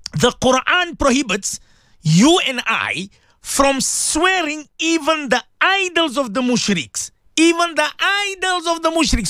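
high-pitched, insistent sermon delivery; impassioned preaching. Real, not acted. Radio preacher warning listeners about sins of the tongue, impassioned. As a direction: preach it high, driving each phrase home.

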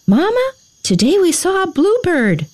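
put-on high, eager little boy voice; childlike excitement, high and lilting; excited little boy. A narrator's reading. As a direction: pitch it high like an excited child sharing news. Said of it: "excitement in his soft voice".

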